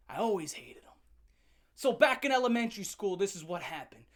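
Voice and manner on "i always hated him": whispered hatred; whispered confession. Acted. Confessing grudge.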